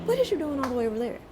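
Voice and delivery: fast, laughing incredulous question; laughing disbelief. Retold or imitated. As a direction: ask it half laughing in disbelief. Incredulous at nephew's location.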